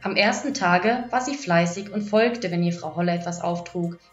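usual speaking voice

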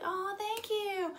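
high, drawn-out sweet thanks. Retold or imitated. Star thanks the fan for the compliment, high impression. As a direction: imitate a cooing pop star, very slow. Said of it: "And a bit more [name]-y".

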